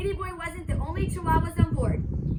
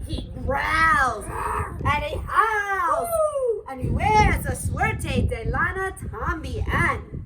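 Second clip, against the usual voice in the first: slow spooky telling; 5 dB louder, much slower than usual. Acted. Don Diego describing bully.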